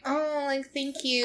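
high, soft, underwhelmed thank-you; a small, high voice of feigned gratitude; mock-polite and hushed; polite thanks, imitated.

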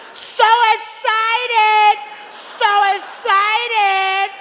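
high, slow, drawn-out squeal of "So excited!"; mock cheerful ride operator, high and slow; imitating the ride attendant. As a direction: stretch every syllable up high like an overjoyed attendant. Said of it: "I said".

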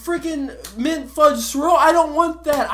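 high, loud, exasperated ranting; angry rant. Real, not acted. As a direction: rant it at the top of your voice. I'm so angry.

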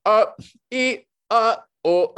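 high, loud broken-up syllables; crazy frame-by-frame noises. Retold or imitated. Alone in his apartment recording audio, high stuttered noises. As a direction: chop the sounds into single frames like a madman. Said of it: "sounding like a crazy person".

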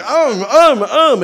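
loud, high insistent "um"; imitated baby food grunts. Baby asking dad for another piece, imitated food grunts. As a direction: mimic a baby pestering for food. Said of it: "he does this thing when he wants to eat".

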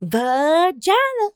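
"Vagina" high and very drawn out; mock exclaimed VAGINA. Imitating Peggy's workout word, "Vagina" high and slow. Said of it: "someone screams the word vagina".

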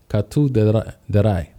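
Katudarai spoken slowly, syllable by syllable; slow careful syllables. Shown as a demonstration. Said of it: "Slow down, slow down".

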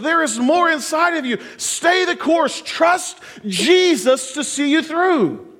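high, driving exhortation, slowing hard on the last appeal; impassioned preaching. Acted. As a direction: urge the congregation on in a high preacher's voice. Preaching at full force.

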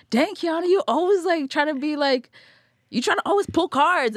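high, loud mock call-out of herself; self-mocking scold. Host mocking her own habit of pulling cards, high and loud. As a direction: pitch way up and scold yourself like a friend would. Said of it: "I'm like".

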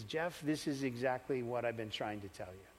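very soft, low, hushed voice; imitated divine whisper. God's Spirit speaking to the trapped boy, hushed near-whisper. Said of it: "Spirit of the living God whispered out to me".

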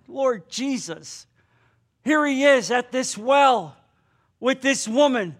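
ordinary delivery

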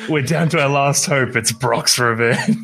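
high, hammed-up last-hope line; mock movie hero. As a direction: play a dramatic film moment, drawing out the name. Mock film line about silly mech name.

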